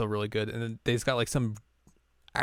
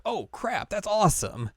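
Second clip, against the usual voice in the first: much higher, thrilled quoted outburst; surprised delight; 10 semitones higher than usual. Retold or imitated. Fan recalling finding a main character, surprised delight. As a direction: pop up high with delighted surprise.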